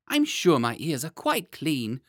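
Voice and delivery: put-on high, squirming child protest; a wriggling boy pushing the nurse away; whiny small boy.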